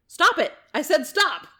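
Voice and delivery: loud, high shout of command; angry yell. Acted. Retelling their yell at the ghost, loud angry shout. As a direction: shout it like you're pissed. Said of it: "I yell again".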